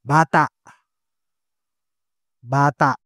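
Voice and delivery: "Bata" with crisp open vowels; model word. Shown as a demonstration. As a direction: say "Bata" clearly. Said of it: "Next sample". (malinaw na "Bata")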